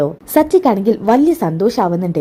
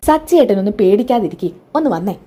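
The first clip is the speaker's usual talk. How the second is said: plain reading voice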